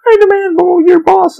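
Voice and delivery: shrill, high mock demanding customer; entitled guest. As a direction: squeal it like a spoiled guest demanding the boss. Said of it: "there's some people that are like".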